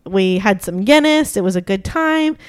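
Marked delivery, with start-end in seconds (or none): none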